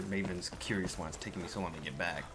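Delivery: ordinary talk, nothing marked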